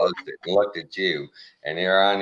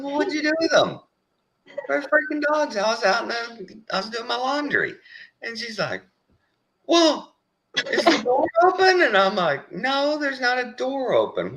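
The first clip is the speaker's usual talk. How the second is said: much higher mock exchange; retold bickering. Husband reenacting dog argument with wife, high mock voices. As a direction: play both sides squeakily.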